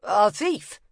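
loud, short copper's answer; curt copper. Acted. Copper answering the rag picker, curt and loud. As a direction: snap it like an impatient cop.

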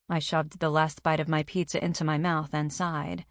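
plain reading voice

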